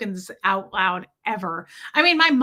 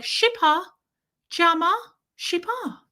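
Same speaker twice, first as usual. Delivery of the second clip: put-on high-pitched nonsense baby talk; weird pet babble; 8 semitones higher than usual. Shown as a demonstration. Owner making weird sounds to Gertie, high pet babble. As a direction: coo made-up words at a pet, one at a time. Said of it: "I make these weird sounds".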